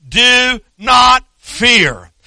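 slow, drawn-out declaration; prophet declaring. Retold or imitated. Elisha declares to his servant, slow and firm. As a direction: stretch it out like a calm command. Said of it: "Elisha declared".

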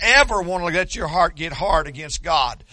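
usual speaking voice

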